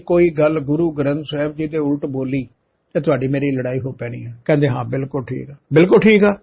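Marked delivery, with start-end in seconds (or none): 4.7-5.5 quiet agreement. Retold or imitated. 5.7-6.4 incredulous echo. Retold or imitated.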